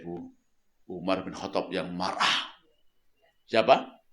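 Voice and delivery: ordinary talk, nothing marked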